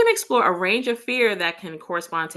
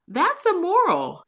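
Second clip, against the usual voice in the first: indignant; 11 semitones higher than usual. Acted. Character objects in quote.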